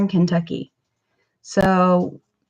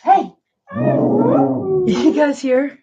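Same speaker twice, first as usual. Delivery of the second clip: excited; 10 semitones higher, much slower than usual. Real, not acted. Excited about dog talking.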